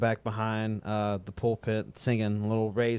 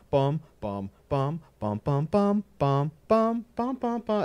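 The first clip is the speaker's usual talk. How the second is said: mouth bass beat; 5 semitones higher, slower than usual. Shown as a demonstration. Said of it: "the standard, the one and three".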